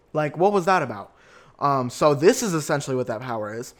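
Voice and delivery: ordinary talk, nothing marked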